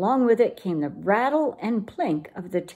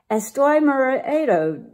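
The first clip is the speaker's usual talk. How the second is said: mild cry; 4 semitones higher, 4 dB louder than usual. Acted. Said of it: "cried Scipio".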